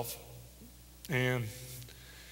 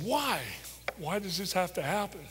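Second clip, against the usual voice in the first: choking up; 7 semitones higher than usual. Real, not acted. Father recalling his son's death, voice breaking. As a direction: voice cracking high with held-back tears. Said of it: "I know you don't cry".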